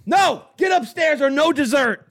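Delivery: high, loud mock yell at a kid; parent yelling. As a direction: bark orders upstairs like a fed-up mom. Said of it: "That's all I hear".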